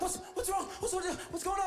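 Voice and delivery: high, frantic mock stammer; panicked manager. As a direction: stumble over it in a high panic. Panicked manager runs over.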